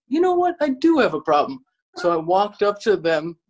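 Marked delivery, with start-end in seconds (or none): 0.1-1.6 retold confrontation. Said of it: "And I was like".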